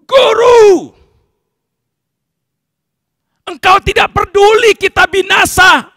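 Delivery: high, very loud cry for the master; angry shouting. Acted. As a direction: disciples yelling in the storm. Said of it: "lalu berteriak". (berteriak marah-marah)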